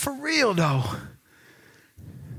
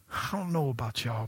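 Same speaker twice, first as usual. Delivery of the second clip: ordinary talk, nothing marked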